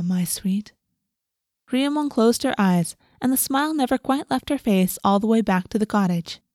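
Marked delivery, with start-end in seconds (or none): 0.0-0.7 soft whisper; whispered endearment. A narrator's reading. A guardian whispering to her ward, tender whisper. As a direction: breathe the words tenderly.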